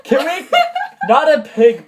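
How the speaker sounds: high, loud, through leftover giggles; laughing while reading. Real, not acted. Reader trying to continue the story, still laughing.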